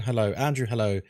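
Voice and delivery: ordinary talk, nothing marked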